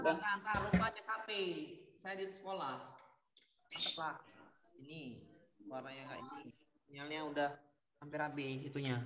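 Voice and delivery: quiet, far from the microphone; talking aside, away from the phone; off-mic aside. Real, not acted. Teacher talks away from phone.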